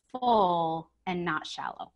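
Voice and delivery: ordinary delivery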